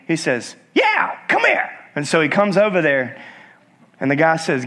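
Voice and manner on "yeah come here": very high squawked parrot summons; high-pitched talking parrot. Acted. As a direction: squawk it like a parrot calling someone over. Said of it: "the parrot says".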